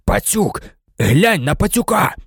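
strained, desperate exclamation; hoarse cry. A narrator's reading. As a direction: urge them hoarsely to look. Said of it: "прохрипів він".

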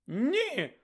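high shocked cry of no. Acted. McGonagall rushing down to stop Moody, high cry. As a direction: cry out in horror. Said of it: "вигукнула професорка МакГонагал".

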